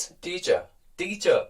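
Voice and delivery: "teacher" in a British accent with no final r; British teacher demo, no r at the end. Shown as a demonstration. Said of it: "जब हम British की बात करते हैं".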